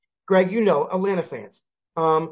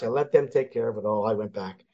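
usual speaking voice

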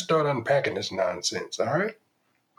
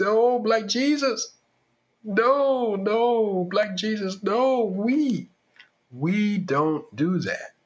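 hammed-up grandmother pleading; 7 semitones higher, much slower than usual. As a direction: beg heaven in mock despair.

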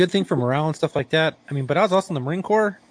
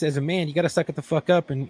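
ordinary talk

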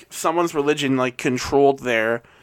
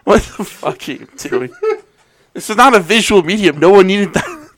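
loud, higher-pitched exasperation; exasperated scolding; 6 semitones higher, 5 dB louder than usual. Real, not acted. Co-host scolding host over visual gag, exasperated. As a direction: scold a friend in raised disbelief.

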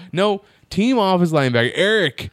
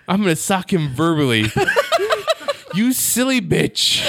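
laughing; 5 semitones higher than usual. Real, not acted. Mock insult while laughing.

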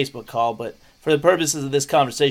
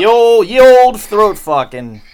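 loud, slow, raised announcement; mock olde-worlde proclamation; 5 semitones higher, 11 dB louder, slower than usual. Host renaming the act for co-host, mock olde-worlde proclamation. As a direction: proclaim it slowly with hammed-up old-time pomp. Mock archaic flourish on repeated phrase.

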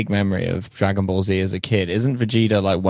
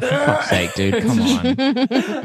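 exasperated partner; much slower than usual. Retold or imitated. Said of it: "you're like".

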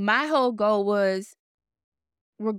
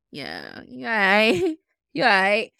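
unconvinced mock approval; 3 dB louder than usual. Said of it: "look at myself and be like".

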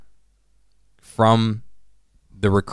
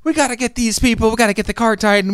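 hammed-up urgency; 12 semitones higher, 4 dB louder than usual. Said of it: "they're like".

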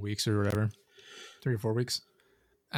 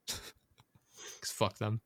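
laughing under breath; 9 dB quieter, much slower than usual. Real, not acted. Laughing aside after joke.

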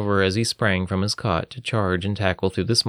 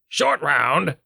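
scoffing; 7 semitones higher than usual. Acted. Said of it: "Richter scoffed".

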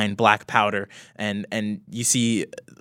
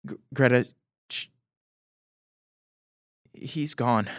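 soft consoling; 4 dB quieter than usual. Acted. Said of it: "he goes".

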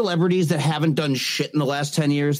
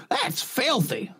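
put-on slow, soft disgusted old Southern lady; old Southern mom; 7 semitones higher, 5 dB quieter, much slower than usual. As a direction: drawl it like a prim old Southern mother. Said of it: "old and Southern in my head".

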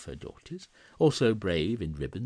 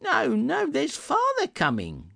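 put-on very high, loud children's alarm; excited children crying out; 8 dB louder than usual. A narrator's reading. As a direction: call out like kids hurrying to hide her. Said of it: "cried the two young Cratchits".

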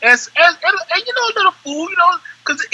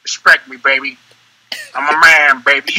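lower-pitched, with pauses between phrases; said another way; 4 semitones lower than usual. Shown as a demonstration. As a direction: drop the voice and space it out. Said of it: "Or I can say it like".

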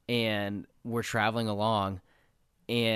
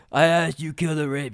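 loud, higher-pitched monster captor; Fomorian boss; 5 semitones higher, 7 dB louder than usual. Retold or imitated. Fomorian captor correcting the bard, loud and insistent. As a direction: push it out as an impatient brute. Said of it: "He goes".

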